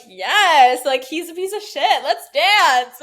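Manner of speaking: high, loud, hammed-up glee; gleeful mock reaction. Guest acts out dancing to a breakup song, gleeful. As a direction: toss off the insult then cheer. Acting out her carefree reaction.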